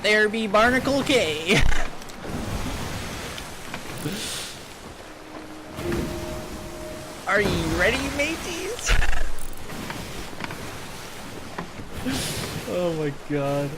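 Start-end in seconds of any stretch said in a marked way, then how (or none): 0.0-1.6 slow, high, louder goofy pirate. Acted. 1.7-7.2 pirate cackle. Acted. 7.3-8.9 high, rising pirate call to the crew; rally the crew like a silly pirate. Acted. 8.9-12.6 pirate cackle. Acted.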